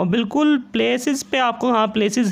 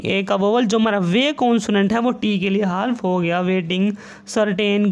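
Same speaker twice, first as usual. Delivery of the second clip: ordinary delivery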